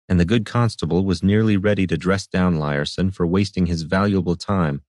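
ordinary delivery

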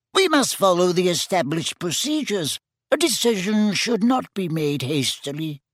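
high, small squeaky wizard; squeaky little voice. A narrator's reading. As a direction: pipe it up in a tiny squeak. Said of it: "in his squeaky little voice".

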